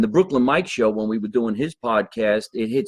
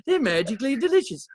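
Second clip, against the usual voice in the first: leprechaun voice; 11 semitones higher than usual. Acted. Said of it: "we sound like leprechauns".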